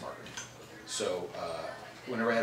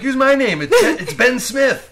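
high, loud, pleading fan; eager fan voice; 17 dB louder, slower than usual. Retold or imitated. Mimicking eager fans.

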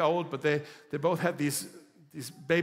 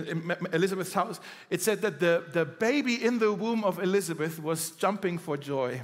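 ordinary talk

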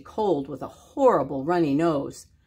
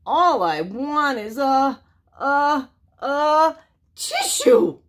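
higher and louder, slow build to a sneeze; wolf about to sneeze; 6 semitones higher, 4 dB louder, slower than usual. Acted. Sick wolf begs the pig for a tissue, building to a sneeze.

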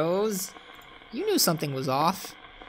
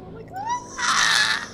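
sudden high scream of fright; scared scream; much slower than usual. Real, not acted. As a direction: shriek at the jump scare. Jump scare.

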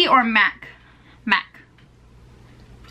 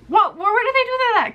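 high, disbelieving question; sassy disbelief, pitched way up; incredulous; 11 semitones higher than usual. Real, not acted. Incredulous rant about replies.

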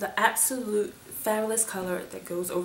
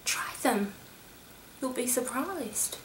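whisper; 4 semitones higher, 10 dB quieter than usual. Real, not acted. Coaxing viewers.

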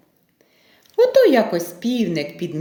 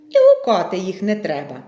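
ordinary delivery

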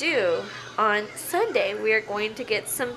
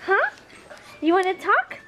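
pet talk; 9 semitones higher, 3 dB louder than usual. Real, not acted. Owner coaxing her dog to bark back, high pet talk. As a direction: coo it up high at the pup.

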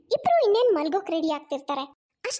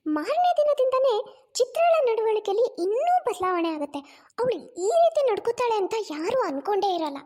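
usual speaking voice

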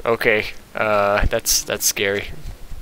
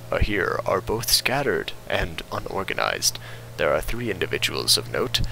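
reading a log; 6 semitones lower, 6 dB quieter than usual. Acted. Reads in-game log.

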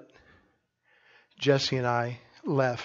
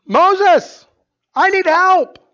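much higher and louder, a desperate cry; crying out to God; 13 semitones higher, 11 dB louder, much slower than usual. Shown as a demonstration. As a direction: plead loudly for help.

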